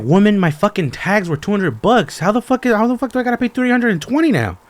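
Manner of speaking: re-enacting his outburst at the DMV clerk, mock furious yell; mock outraged yell. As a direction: yell it like you've just been robbed, half for laughs.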